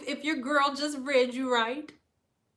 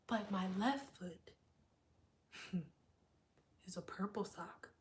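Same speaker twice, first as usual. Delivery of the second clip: mysterious hush; 3 semitones lower, 9 dB quieter than usual. Retold or imitated. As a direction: keep them guessing, hushed. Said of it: "Geminis be like".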